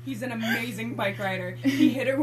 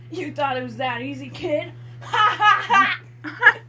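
typed-out villain laugh; 3 semitones higher, 5 dB louder than usual. Acted. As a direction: tease him like a cartoon villain, then laugh it out. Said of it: "I am going to do it exactly as it is typed out".

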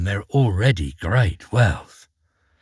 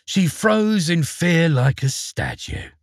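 ordinary delivery

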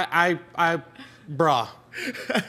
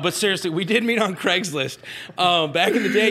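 ordinary delivery

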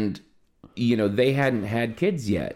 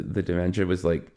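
usual speaking voice

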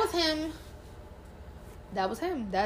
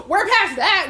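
laughing through the words; laughing loudly at the memory; 8 semitones higher, 10 dB louder, slower than usual. Real, not acted. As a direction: quote it while laughing, higher and louder. Laughing while quoting herself.

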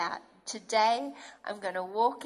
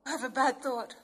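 high, near-crying panicked pleading; imitating an anxious believer in a panic; panicking; 10 semitones higher, faster than usual. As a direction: act out spiralling panic, much higher.

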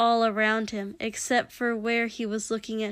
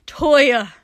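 higher, very loud cry of a name; desperate shout; 4 semitones higher, 13 dB louder than usual. A narrator's reading. Shoto calling to his brother, desperate shout. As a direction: call out to stop him.

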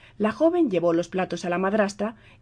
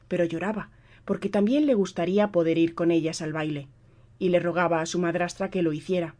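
ordinary delivery, nothing marked

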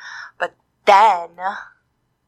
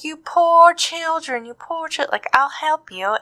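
high, slow, sympathetic; pitying witch voice; 8 semitones higher than usual. Retold or imitated. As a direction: coo over poor children.

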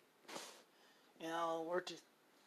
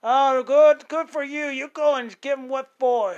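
put-on old fellers cheering; 6 semitones higher, 10 dB louder than usual. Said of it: "old fellers".

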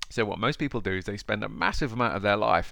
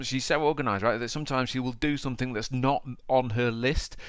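normal talking voice

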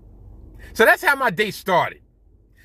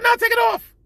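insistent; 3 dB louder than usual. Retold or imitated. Insists again after refusal.

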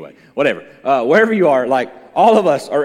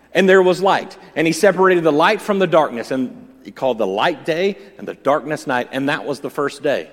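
louder scripture reading; booming biblical narration; 5 dB louder than usual. Acted. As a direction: proclaim the creation story. Reads Genesis one aloud.

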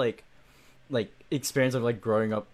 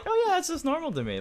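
breezy casual. Retold or imitated. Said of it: "that's just like".

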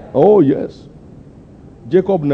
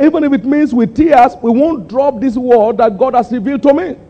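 high, pleading declaration; impassioned vow; 10 semitones higher than usual. Retold or imitated. Preacher quoting his old letter to the church, high and impassioned. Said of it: "I said".

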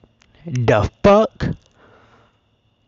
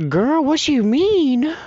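put-on much higher, slow "girl, what you mean"; incredulous retort; 13 semitones higher, slower than usual. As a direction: drag it out high in mock disbelief. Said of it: "I was like".

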